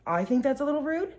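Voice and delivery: retold questioning comeback, high-pitched; pointed echo. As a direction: throw it back with a rising end. Echoes the accusation back.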